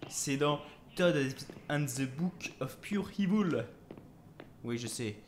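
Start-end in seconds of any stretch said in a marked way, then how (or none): 1.7-3.7 slow English title with a French accent. Real, not acted. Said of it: "Mon accent anglais est très". (anglais avec accent français)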